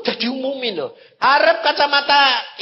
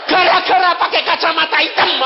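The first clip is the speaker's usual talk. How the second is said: higher, louder mock scolding; loud blaming; 6 semitones higher, 7 dB louder than usual. Guide blaming the man's dark glasses, high loud scolding. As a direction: blame him loudly, hammed-up.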